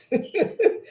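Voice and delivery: sudden high giggle; high burst of laughter. Real, not acted. Host cracking up at Ricardo's message. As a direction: let a high laugh escape.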